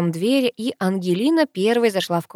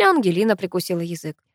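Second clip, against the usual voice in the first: ordinary delivery, nothing marked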